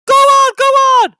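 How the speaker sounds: very high, loud squealing taunt; mischievous trucks. A narrator's reading. Trucks pushing Thomas downhill, squealing taunts. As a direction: egg him on gleefully.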